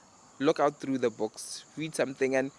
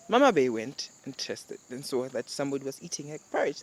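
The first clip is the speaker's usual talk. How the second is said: usual speaking voice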